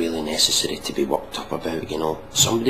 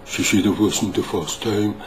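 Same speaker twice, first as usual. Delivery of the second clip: deadpan delivery with no enthusiasm; mock flat punchline. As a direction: imitate a limp joke-teller. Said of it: "It's not exactly captivating the audience".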